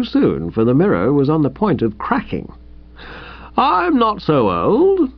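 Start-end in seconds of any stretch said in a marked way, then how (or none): 3.6-5.1 slow, high and musing. A narrator's reading. Uncle George musing to Bertie, slow and reflective. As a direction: think it over aloud, slowly. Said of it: "he said in a musing sort of voice".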